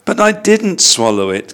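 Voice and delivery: high, flustered little bear; gasped protest. A narrator's reading. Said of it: "gasped Paddington".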